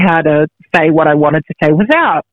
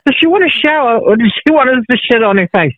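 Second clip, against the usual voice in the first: mock bloke voice; 6 semitones higher than usual. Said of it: "it would be like".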